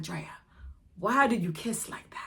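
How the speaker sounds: lightly voiced mock version of herself; imitating herself. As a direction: replay her own question with a put-on voice. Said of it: "I'ma really start acting like".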